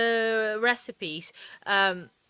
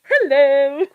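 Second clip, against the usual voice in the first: very loud, higher, giggly hello; laughing call; 4 semitones higher, 14 dB louder than usual. Real, not acted. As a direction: holler hello through laughter. Calls to cameraman amid laughter.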